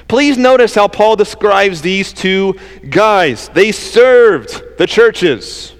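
ordinary talk, nothing marked

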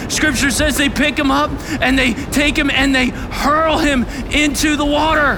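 high, loud, driving preaching; impassioned pulpit shout; preaching at full force. Real, not acted.

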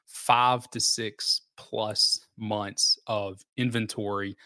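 "five to six plus months" said slowly, each word weighted; slow, stressed definition. Shown as a demonstration. Defining a buyer's market.

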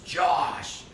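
sudden call-out. Retold or imitated. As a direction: call out to a friend to get his attention. Friend suddenly calls out to Josh.

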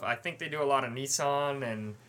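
ordinary delivery

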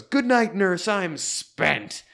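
high, loud spent gasp; worn-out, satisfied exclamation; exhausted sigh of relief. Retold or imitated. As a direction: flop back and exhale it, high and loud. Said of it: "flops back in the, in that leather easy chair and just goes".

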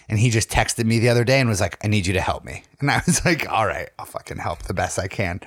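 ordinary delivery

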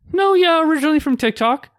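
high, pointed hint; unsubtle hinting. Retold or imitated. Admitting how he knows her, high unsubtle hint. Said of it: "I wasn't subtle about it".

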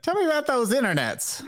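put-on high old lady voice; a fussy grandma asking questions. Joining the grandma bit.

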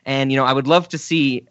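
ordinary talk

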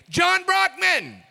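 loud, high shout across the street; calling out. Retold or imitated. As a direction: yell the name to a friend far ahead. Yelling a friend's name across street.

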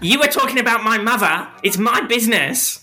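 high, quick huffy retort; indignant. Acted. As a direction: snap back, offended. Rival confronts in character.

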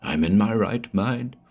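put-on dazed old man, rising unease; strange voice. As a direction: speak strangely, half to himself. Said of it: "Then he said strangely".

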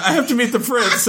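high mock quote through laughter; mocking Cinderella, laughing. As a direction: repeat her excuse laughing at it.

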